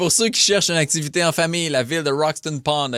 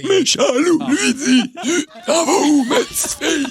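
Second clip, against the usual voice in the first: talking while inhaling; 12 semitones higher than usual. Pretend. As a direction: gasp the wolf's lines breathing in. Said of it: "en parlant en aspirant".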